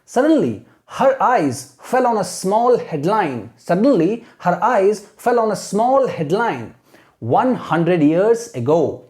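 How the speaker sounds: very slow dramatic read-aloud; slow storytelling demo; slow model reading. Shown as a demonstration. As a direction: read each word slowly for students to copy. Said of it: "imitate करना है".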